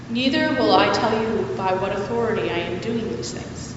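ordinary delivery

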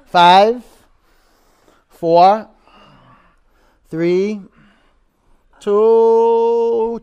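loud, rising coach countdown; shouted countdown. Real, not acted. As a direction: call out each number to push them.

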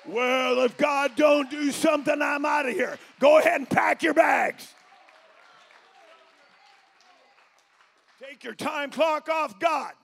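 loud, high, shouting preacher's cry; full-force preaching. Real, not acted. Pastor shouting to the congregation, full-force preaching. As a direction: preach it out at the top of your voice.

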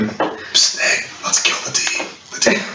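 unvoiced conspiratorial whisper; stage whisper. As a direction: whisper it like a secret plot. Whispers plot against the DM.

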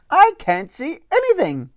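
high-pitched kitty voice; little cat voice. Acted. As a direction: speak as a tiny cat. Cat Carl speaks.

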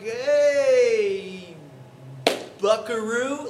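mock hearty greeting; awkward cover. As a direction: fake confidence to hide a blank. Awkward reply without name.

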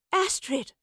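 high-pitched call of recognition; joyful cry. A narrator's reading. Professor spots her young friend, crying out high. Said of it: "the professor cried".

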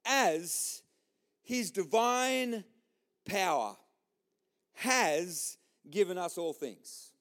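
word by word, long pauses between; very slow, weighty Bible quote; powerful statement. Shown as a demonstration. Preacher repeating the verse to the church, deliberate. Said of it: "he says this incredibly powerful statement".

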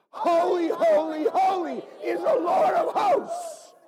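high, loud and joyful, shouted; shouted praise. Shown as a demonstration. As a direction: cry it out like the angels shaking the place. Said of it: "Ready? One, two, three".

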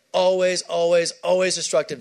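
each always punched loud, the first one highest; preacher pounding on always; hammered always. Acted. Preacher hammers repeated word.

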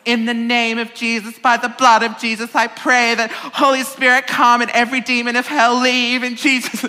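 high, loud, fervent imitation of a charismatic prayer. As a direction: belt it out like a Pentecostal laying on hands. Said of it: "lays hands on me like a good charismatic".

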